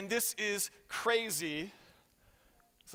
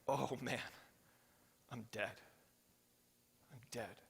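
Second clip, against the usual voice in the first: hushed, much softer mock terror; softly voiced playful despair; mock dread; 7 semitones lower, 11 dB quieter than usual. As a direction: breathe it like a doomed man. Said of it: "He's like".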